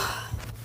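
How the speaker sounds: short breathy sigh; real voiceless sigh. Real, not acted. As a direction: let out a quick exhale. Sighs before moving on.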